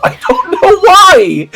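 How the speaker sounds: high laughing cry of disbelief; high slow laughing outburst; laughing exclaim. Real, not acted. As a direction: squeal it through laughter. Laughing at absurd plot.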